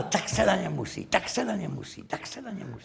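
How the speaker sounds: soft repeated murmur, fading away; imitated private muttering, trailing to almost nothing; quiet retold aside.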